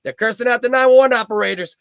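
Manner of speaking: mock alarm.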